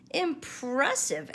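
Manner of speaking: high, slow admiring word; admiring chorus. A narrator's reading. Friends praising Gopher's carved heart. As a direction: draw it out in awe. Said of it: "everyone told Gopher".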